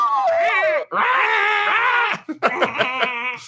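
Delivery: high piercing pterodactyl screech; pterodactyl shriek. Acted. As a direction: let out a shrill dinosaur screech. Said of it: "Do your impression".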